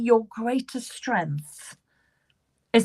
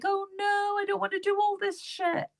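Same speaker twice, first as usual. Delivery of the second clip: reluctant groan; 9 semitones higher, 5 dB quieter than usual. Acted. Reader voices the client not wanting more work, mock reluctant whine. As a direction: whine it as the unwilling client, much higher. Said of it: "You're like".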